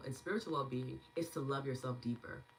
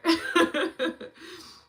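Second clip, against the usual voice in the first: laughing; 13 semitones higher, 12 dB louder than usual. Real, not acted. Laughs after crying.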